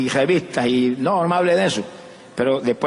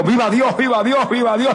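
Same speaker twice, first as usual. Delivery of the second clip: higher, rising cries of Dios; shouting praise; 7 semitones higher than usual. Retold or imitated. Retelling his shout at waking, high cries. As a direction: call out Viva Dios with joy. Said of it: "comencé a gritar a toda voz".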